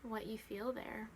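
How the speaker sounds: measured body-scan guidance with long silences; guided meditation. Real, not acted. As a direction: guide attention gently, leaving room after each instruction.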